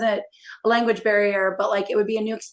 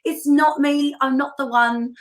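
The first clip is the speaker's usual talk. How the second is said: mock apology to disappointed readers; 6 semitones higher than usual. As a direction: play the over-apologetic author at her book table. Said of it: "I was like".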